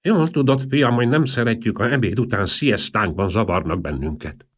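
high, loud, bleating geriatric voice, easing down; bleating old man. A narrator's reading. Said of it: "mondta mekegő, aggastyáni hangon".

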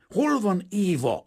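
high, slow, loud shout; furious roar. A narrator's reading. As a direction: roar the question, stretching each word. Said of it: "ordított föl Kazimir".

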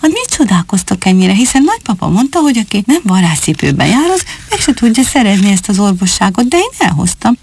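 plain reading voice

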